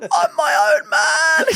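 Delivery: put-on drunk mate. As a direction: draw it out like a defiant drunk. Friend voices the drunk mate.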